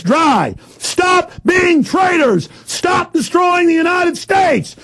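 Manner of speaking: slow, high furious yelling at officials; furious shout. Real, not acted. Ranting host yelling at government spies. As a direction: bellow each accusation, slow and raging. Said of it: "it makes me mad".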